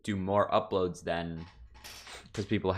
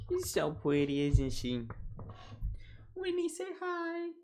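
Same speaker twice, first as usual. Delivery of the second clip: cooing pet voice; 11 semitones higher, 5 dB quieter than usual. Real, not acted. Streamer showing off the cat to viewers, high cooing. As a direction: coo over a cat, climbing very high.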